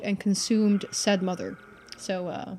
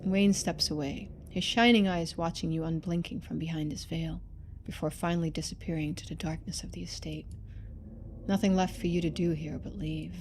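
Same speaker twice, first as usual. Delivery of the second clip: quiet, measured storyteller read; story narration; 4 semitones lower, 5 dB quieter than usual. A narrator's reading. Streamer reading the game's narration aloud, quiet and measured. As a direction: read the scene out softly for the viewers.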